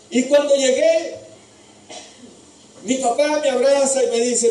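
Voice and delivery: high, loud, impassioned preaching to the congregation; fiery preaching. Acted. Preacher recounts Isaac's story to the brothers, shouting with fire. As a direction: preach it with everything you have. Preacher narrates at full force.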